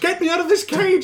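loud, high, frantic begging; desperate pleading shout. Acted. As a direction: scream to be let out. Voicing the caged alien pleading.

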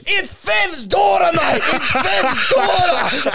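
high, drawn-out shout; mock dramatic announcement. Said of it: "kicks open the door and says".

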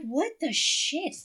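high, long drawn-out disbelief; retold dismay. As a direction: stretch each word in mock outrage. Retold dismay at feeling sick.

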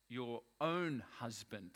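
"own" drawn out slowly with weight; stress on "own". Shown as a demonstration. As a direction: lean long and slow on the word "own". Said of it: "I want to emphasize the word own".

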